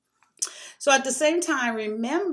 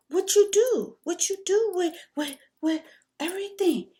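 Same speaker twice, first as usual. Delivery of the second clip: imitating a Mexican man's broken English, much higher voice; broken English Mexican accent; 11 semitones higher than usual. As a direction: put on the Mexican guy's accent, high and eager. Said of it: "he's talking to me in his broken English".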